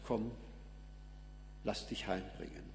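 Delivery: soft, low coaxing; gentle prophet. Acted. Prophet to a defiant child who has given up, gentle and hushed. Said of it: "Und da kommt der Prophet und sagt".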